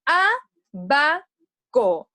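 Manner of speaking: "Abaco" very slowly, A... ba... co; teacher spelling out "Abaco" by sound, slow and loud; syllable by syllable. Shown as a demonstration. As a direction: break "Abaco" into three loud, separated syllables. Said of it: "¿Dónde llevará tilde esta palabra?".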